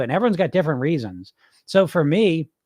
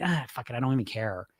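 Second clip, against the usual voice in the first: dropped, much softer, fed up; mock bad-mood dismissal; 4 semitones lower, 9 dB quieter than usual. Coach voicing a viewer in a bad mood, soft and fed up. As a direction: toss it off like you've given up. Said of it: "when you're in a bad mood, you're gonna say".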